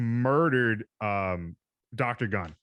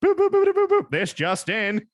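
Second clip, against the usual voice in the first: put-on high, loud old-timey newsreel voice; old radio announcer; 10 semitones higher, 6 dB louder than usual. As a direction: play a hammy vintage radio host. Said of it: "You gotta develop a transatlantic accent".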